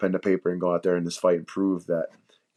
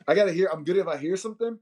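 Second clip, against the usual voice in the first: ordinary talk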